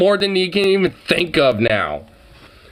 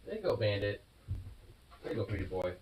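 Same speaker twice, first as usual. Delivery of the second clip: softer, hushed coaxing to the cat; tender and soft; 7 semitones lower, 19 dB quieter, much faster than usual. Real, not acted. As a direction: gently send the cat out, very soft.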